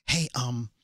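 quiet imitation. Imitating co-host's request.

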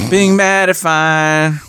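very slow, stretched-out "Being mad is fine"; slow drawn-out. Shown as a demonstration. Teasing Eric about his headset, saying "Being mad is fine" very slowly.

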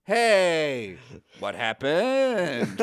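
slow, lightly mocked flat line read; lightly mocked Harry Kim line, very slow; wooden line reading. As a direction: imitate a stiff actor dragging the line out. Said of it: "the way he delivers it".

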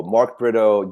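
normal talking voice

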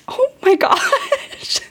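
high, slow, amazed and touched; moved, laughing gasp. Retold or imitated. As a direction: melt at what the child said. Moved by her daughter's words.

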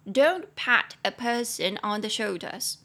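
slow, clear teaching read; slow, careful English model line. Shown as a demonstration. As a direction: spell it out slowly for learners.

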